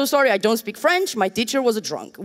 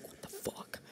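whispered swear, much softer, at the app; frustrated user whispering to a buggy app; exasperated whisper; 27 dB quieter than usual. Retold or imitated. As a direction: hiss it under your breath in frustration. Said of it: "and being like".

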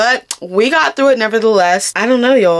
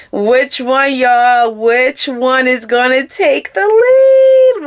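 drawn-out dramatic question; 5 semitones higher, 3 dB louder, much slower than usual. Real, not acted.

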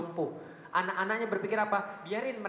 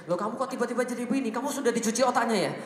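surprised parent; 4 semitones higher, 4 dB louder than usual. Retold or imitated. Parent voiced reacting to child.

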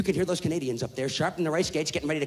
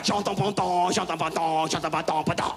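mock French. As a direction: fake French gibberish in a put-on accent. Gibberish French babble.

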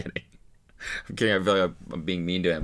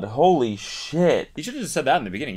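normal talking voice

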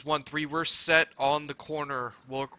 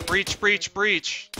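loud, higher urgent command shout; breach call shout; 6 semitones higher, 5 dB louder, much slower than usual. Real, not acted. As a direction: yell it as the team goes in. Team breaching building entrance.

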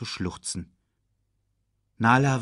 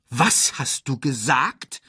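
slow, higher, outraged question; flaring up; 5 semitones higher, slower than usual. A narrator's reading. Scar reacts to Sasu naming Mufasa, flaring up. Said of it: "ging Scar hoch".

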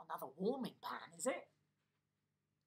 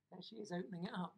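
ordinary delivery, nothing marked

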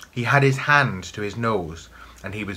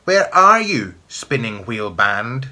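screaming witch voice; 2 semitones higher, 8 dB louder, slower than usual. A narrator's reading. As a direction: scream the question, then draw out the name. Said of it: "screamed the voice".

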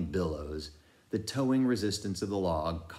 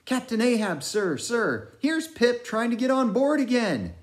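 high, loud, excited sailor calling out; frantic old sailor; 7 dB louder than usual. A narrator's reading. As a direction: shout over the sea, searching for Pip. Narrator voices Manxman's frantic shouts.

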